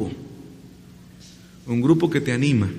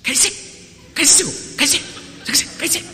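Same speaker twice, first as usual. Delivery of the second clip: hushing crowd; 11 semitones higher, 3 dB quieter than usual. Acted. Crowd hushing the blind man.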